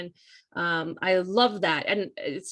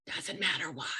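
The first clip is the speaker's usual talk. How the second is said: whispered aside; 3 semitones lower, 9 dB quieter than usual. Real, not acted. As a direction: drop to a whisper, conspiratorial.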